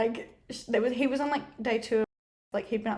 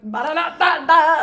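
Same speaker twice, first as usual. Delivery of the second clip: loud, slow, high ba-da-da fanfare; hammed-up showbiz noise; mock performance noise; 5 semitones higher, 11 dB louder, slower than usual. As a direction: belt out nonsense syllables like a showy act. Imitates putting on a big performance.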